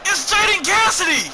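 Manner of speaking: high, loud hype shout of the name; sudden high shriek of excitement; ring announcer shout. Acted. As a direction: bellow it like the arena announcer. Announcing a wrestler's entrance.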